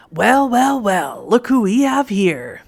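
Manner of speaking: slow, gloating villain drawl; cruel amused voice. Acted. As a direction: savor every word like a cruel predator. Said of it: "A cruel, amused voice".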